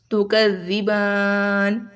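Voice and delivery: very slow, last vowel of "زبان" held six counts; six-count elongation. Shown as a demonstration. Teacher showing a six-count stop, last vowel at its longest. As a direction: stretch the aa in "زبان" to the fullest before n. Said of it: "اگر چھ حرکت کے برابر لمبا کریں".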